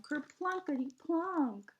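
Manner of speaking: ordinary delivery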